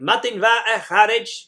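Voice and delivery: greeting called out loud to the group. Shown as a demonstration. Teacher calls the greeting round the group. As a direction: stress on "va", rising, called across. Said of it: "use a greeting like".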